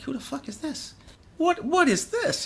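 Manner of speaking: high, stunned disbelief, slowing on the repeat; mock the gawking bassists, voice shooting up; incredulous bass players. Bass players staring at his vintage bass, incredulous. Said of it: "the bass players are looking at me like".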